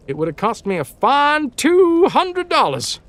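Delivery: ordinary delivery, nothing marked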